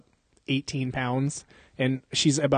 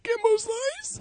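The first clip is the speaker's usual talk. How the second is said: tiny, very high-pitched plea; mock terrified squeal; 3 dB quieter, much slower than usual. Beaten Zach says his attacker's name, mock terrified squeal.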